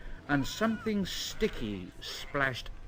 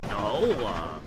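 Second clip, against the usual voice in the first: teasing tram; 2 semitones lower than usual. A narrator's reading. Toby teases James.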